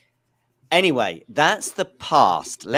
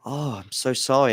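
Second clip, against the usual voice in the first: mock sympathy as Larry; 2 semitones higher, slower than usual. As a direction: play Larry's overdone shocked sympathy, slowly. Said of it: "And Larry's like".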